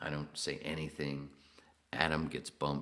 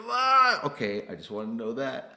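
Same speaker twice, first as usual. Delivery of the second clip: mock relieved reply; 10 semitones higher, 12 dB louder than usual. Retold reply, laughing.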